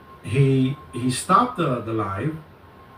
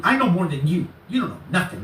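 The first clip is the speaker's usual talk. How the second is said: higher-pitched mock know-it-all retort; mimicking his boasting; 6 semitones higher than usual. Imitating the man belittling the lawyer, mock know-it-all. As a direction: imitate him talking down, voice raised in pitch. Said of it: "he was telling me, right?".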